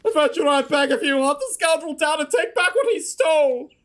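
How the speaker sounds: high, fretful, near-tearful plea; a worried bird breeder fretting over a lost egg; anxious Lucolo. Acted. Lucolo pleads with the adventurer about his egg, high and fretful.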